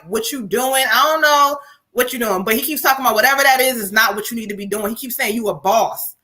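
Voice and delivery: ordinary talk, nothing marked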